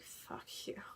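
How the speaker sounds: bitter thought whispered under the breath; hushed, voiceless retort; whispered curse. Retold or imitated. Unspoken angry thought, recounted.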